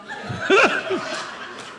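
real high-pitched laugh; amused laugh in bursts; laughter. Real, not acted. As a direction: crack up at your own joke. Laughs after paper towel joke.

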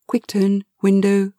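usual speaking voice

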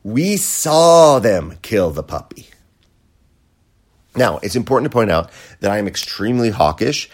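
usual speaking voice

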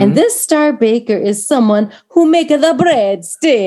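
put-on laughing through an Italian accent; hammed-up Italian accent, cracking up. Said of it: "your great accent?".

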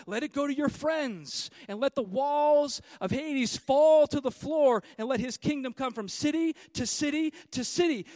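loud, driving preacher's proclamation; thundering pulpit declaration; full-force preaching. Acted. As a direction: hammer it home to the congregation.

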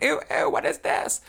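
high, loud squeal of disgust; mock disgusted scream. Drew as things roll out to him, screaming in disgust. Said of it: "and he screams".

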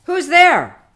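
loud, high challenge through a door; calling out. Acted. Sexton at the church door challenges a stranger, calling out. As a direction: call out sharply to an unseen intruder. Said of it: "and called out".

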